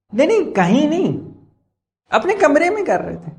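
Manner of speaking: high, whiny mock denial; mocking excuse. Lecturer mimicking people who dance in private, high mocking whine. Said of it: "اب کہتے کیا ہیں ایسے لوگ؟".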